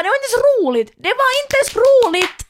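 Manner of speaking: rising high, loud exasperated rant; exasperated shout. Real, not acted. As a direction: shout it down in disbelief. (upprört utrop)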